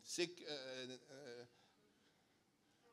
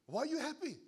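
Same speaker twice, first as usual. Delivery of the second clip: joke question; 11 semitones higher, 5 dB louder than usual. Retold or imitated. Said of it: "asked the guy".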